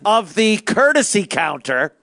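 slow, overly precise complaint; slow fussy caller. Pretend. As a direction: drag out every detail, prissy. Mocking the caller's fussy detail.

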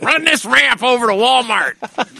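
high, slow fake Chinese accent; a crude fake Chinese accent, slowed down; mock Chinese accent. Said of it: "worst Chinese accent".

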